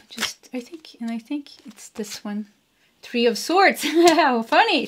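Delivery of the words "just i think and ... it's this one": under the breath, unvoiced; a private whisper while picking a card. Real, not acted. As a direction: whisper it to yourself. Choosing a card, to herself.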